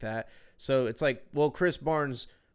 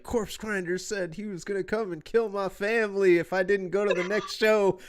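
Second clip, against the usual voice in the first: higher, half-laughing fan impression; a fan babbling at a show, mock voice; imitating a rambling fan; 8 semitones higher, 4 dB louder than usual. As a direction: mimic the dazed concert-goer. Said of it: "and he's like".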